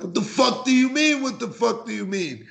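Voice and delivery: high shouted question; retold yell. Host showing how his texts read, yelling. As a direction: bark the question back. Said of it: "And I'm yelling".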